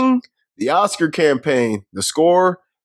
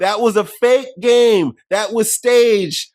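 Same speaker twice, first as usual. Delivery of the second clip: very slow, higher, emphatic accusing; mock insistence; 6 semitones higher, much slower than usual. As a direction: hammer each word slowly. Quoting movie accusation.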